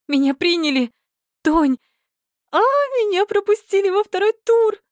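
high joyful shriek; shrieking jackpot winner. A narrator's reading. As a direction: scream it out with pure joy. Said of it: "закричала от радости".

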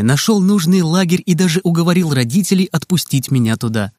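ordinary delivery, nothing marked

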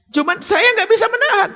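high, strained plea as the woman; a lightly played woman admitting she can't resist; pleading woman. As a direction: voice her helpless confession up high. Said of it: "kalau tanya Ustadz".

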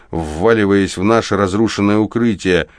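usual speaking voice

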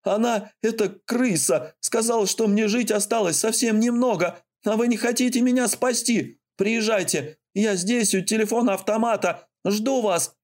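high, frantic rush of words; panicked pleading. Acted.

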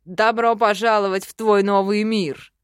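growling demon voice; inhuman growl. A narrator's reading. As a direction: growl it right in her ear. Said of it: "рычит на ухо нечеловеческий голос".

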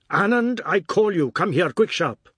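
high, loud sharp order; angry command. Acted. Father ordering his hesitant son over, angry command. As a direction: summon the boy sharply.

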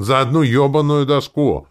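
loud, high outburst dropping to a slow finish; exasperated, heavily stressed swearing; outraged exclamation. A narrator's reading. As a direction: explode in indignation. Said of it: "воскликнул Таварин".

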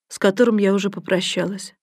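ordinary delivery

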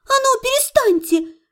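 indignant, rising to a high cry; barking protest. A narrator's reading. Patient refusing to be soothed, indignant high bark. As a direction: protest firmly, then let the last command leap up high. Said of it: "рявкнула я во всю мощь лёгких".

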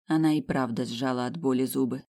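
usual speaking voice

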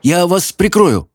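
put-on a frightened boy trying to sound protective; trembling voice. A narrator's reading. Scared apprentice promises to shield Sophie, voice trembling. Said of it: "дрожащим голосом проговорил он".

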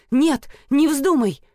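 high loud urgent shout. Acted. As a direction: yell to stop him. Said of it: "Я моментально выкрикнула". (громкий испуганный крик)

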